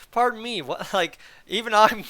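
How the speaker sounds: hammed-up baffled pardon me; playing confused. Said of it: "I'm trying to play confused".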